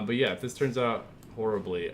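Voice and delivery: ordinary talk, nothing marked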